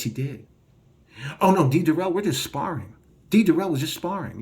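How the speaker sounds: ordinary talk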